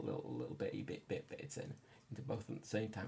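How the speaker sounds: whispering under the breath while concentrating; whispered. Real, not acted. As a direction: hush it out, focused on tiny parts. Fiddly delicate repair work.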